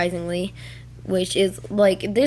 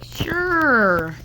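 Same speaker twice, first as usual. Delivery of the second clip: high, stretched-out singsong yes; drawn-out word swooping down from high; mock agreement; 6 semitones higher, 4 dB louder than usual. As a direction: imitate an overeager consent. Retold teacher's consent.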